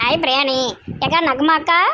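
ordinary talk

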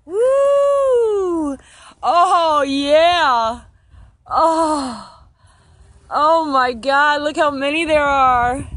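high, loud whoops, then slow gushing; overjoyed, cheering at the hive; ecstatic joy. Real, not acted. Beekeeper sees how many bees there are, loud joyful cheering.

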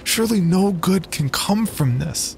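high, earnest pleading. Acted. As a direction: beg your brother to stop. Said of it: "I pleaded".

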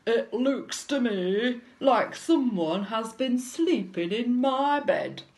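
loud, booming big bear; growly, pondering bear with rising indignation; Daddy Bear. A narrator's reading. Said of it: "Daddy Bear stroked his chin and said".

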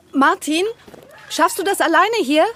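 loud and high, calling across the room. Acted. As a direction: shout over to a colleague some way off. Calling across the garden to Martin.

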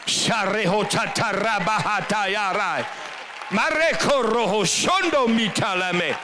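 slow, rhythmic tongues prayer; speaking in tongues. Real, not acted. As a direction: pray in tongues, unhurried. Praying in tongues after praise.

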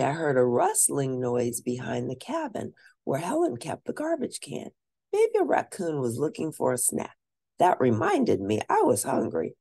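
ordinary delivery, nothing marked

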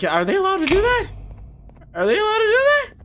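very high squeaky mock disbelief; incredulous retold reaction. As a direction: squeal the question in a put-on high voice. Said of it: "I was like".

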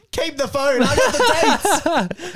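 high, slow gloating yell; triumphant mock cry. Teasing his friend about fleeing the shop, hammed-up gloating shout. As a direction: crow it out like a thief who won. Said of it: "you're like".

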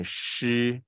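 drawn-out, clearly articulated 诗; retroflex sh demo. Shown as a demonstration. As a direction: model 诗 slowly in a level tone. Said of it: "S H是念诗".

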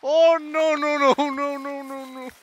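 loud, high string of nos, fading at the end; panicked no no no. Real, not acted. Gamer caught by the monster, panicked and pleading. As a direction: babble in real panic.

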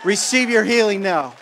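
high, loud, shouted command; loud preaching. Real, not acted. Preacher to the sick, a loud shouted call. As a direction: proclaim the healing at full force. Preaching, then repeated softer.

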